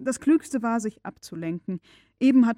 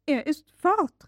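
higher, slow alarmed call; 6 semitones higher, 4 dB louder, slower than usual. A narrator's reading. Old servant Martha rushing in after the slammed door, calling out. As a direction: call out in dismay. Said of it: "rief Martha".

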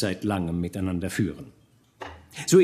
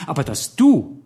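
much higher and louder, voice straining with hurt; hurt tearful outburst; 11 semitones higher, 6 dB louder than usual. A narrator's reading. Marie reproaches Georg, tearful and hurt. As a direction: cry out the reproach through tears. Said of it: "von neuem strömten ihre Tränen".

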